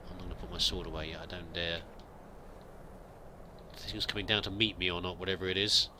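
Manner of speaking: ordinary talk, nothing marked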